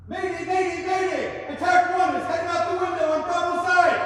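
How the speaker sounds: urgent high shouted distress call; mayday radio call. Acted. Firefighter calling mayday on radio.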